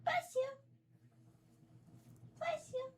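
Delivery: sky-high pet voice blessing a sneeze; very high bless you. Real, not acted. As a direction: squeak it way up high to a kitten. Cat sneezed, cooing.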